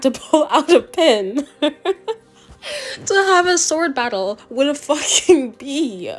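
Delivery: can barely get it out for laughing; real laugh through the words. Real, not acted. Laughing at the sword fight.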